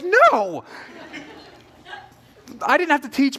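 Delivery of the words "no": exasperated no. Retold or imitated. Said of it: "I'm like".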